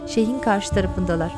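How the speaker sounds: ordinary delivery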